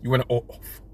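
soft, low mock movie menace; a hushed imitation of a film villain; Nicolas Cage. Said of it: "like, uh, Nicolas Cage in Face Off".

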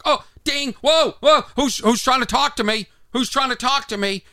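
high-pitched eager alert reaction; mock excitement. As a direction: jump at the sound, acting all worked up. Mimes excited reaction to notification.

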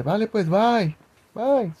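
high, singsong retold farewell; mock casual goodbye. Imita despedida al esposo en voz alta. (despedida imitada, aguda y cantadita)

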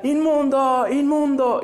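high, loud call of unclean; leper's cry. Retold or imitated. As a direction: cry out unclean to keep people away. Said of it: "tenían que gritar". (grito de leproso avisando que está impuro)

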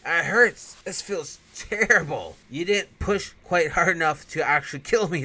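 high, loud, drawn-out groaning complaint; stabbed vampire whining at his attacker; hammed-up pain. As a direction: moan like a melodramatic stabbed vampire.

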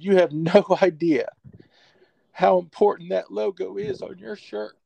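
put-on a touch of a quavering, tearful old coach; retold tearful coach. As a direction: hold back tears as you quote him. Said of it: "he fought back tears".

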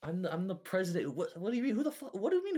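high, indignant executive yelling; a pompous boss offended by intruders in his office; outraged president. Acted. A pompous president yells at intruders, high and indignant.

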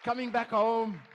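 loud, high exultant cry; jubilant shout. Acted. Preacher celebrating those coming back, shouting with joy.

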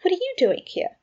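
taken-aback question, pitched high; abashed. A narrator's reading. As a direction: ask it in abashed surprise, stressing WHAT.

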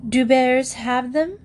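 vague question. A narrator's reading. Mister Brown wondering about passports for bears, vague. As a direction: ask it slowly and vaguely. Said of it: "asked Mister Brown vaguely".

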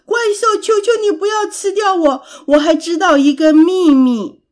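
high, loud, desperate begging; pleading shout. A narrator's reading. Pig offers the monster a secret, pleading shout. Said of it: "艾利克斯大声地喊着".

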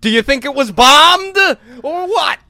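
high, loud, urgent question; excited. Acted. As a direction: blurt it out in alarm. Mock conspiracy question.

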